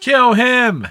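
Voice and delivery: sudden loud outraged yell; shouting. Acted. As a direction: bark it out in disgust. Outburst at Teddy's offer.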